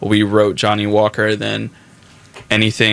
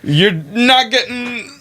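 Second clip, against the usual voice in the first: high-pitched mock urgency, rallying the band; hyped-up demand; 13 semitones higher, slower than usual. Bandmate retelling how they rushed Nick into new music, hyped high-pitched demand. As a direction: bark orders in a squeaky, excited put-on voice.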